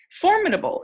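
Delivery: Formidable lifted high and stretched; word read out clearly. Shown as a demonstration. Teacher presenting a new word, high and clear. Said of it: "down here we have formidable".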